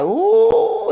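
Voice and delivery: a high drawn-out gasp of mock shock; shocked ooh. The host imitating Drew hearing gossip about Sheree, high mock-shocked "Ooh". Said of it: "Drew sitting there looking like".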